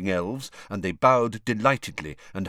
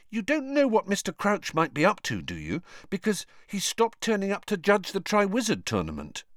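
Harry voice; 11 semitones higher than usual. A narrator's reading. Harry questions Winky.

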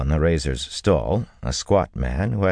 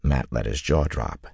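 plain reading voice

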